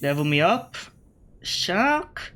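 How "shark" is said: surprised question. Real, not acted. Surprised at opponent's shark.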